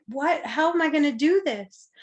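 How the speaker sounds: high, loud bewildered question; retold dismay. Retold dismay at having survived.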